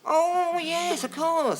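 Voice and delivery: high slow affected agreement; mock realization. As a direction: play it falsely enlightened. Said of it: "And I said".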